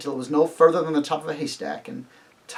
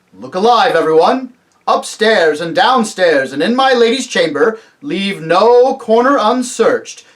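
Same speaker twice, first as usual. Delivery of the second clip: loud, raised commanding voice; rallying call; 5 semitones higher, 9 dB louder than usual. Acted. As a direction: call orders to the whole crowd. Lion gives orders to the crowd.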